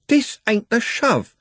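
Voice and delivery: high, slow defiant retort; defiant Trev. Acted. Trev defying Andy, high and slow. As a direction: throw it back at him.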